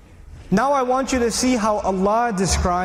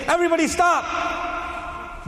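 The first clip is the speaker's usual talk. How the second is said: calling out to a crowd; 9 semitones higher, slower than usual. Retold or imitated. Someone shouting at a crowd.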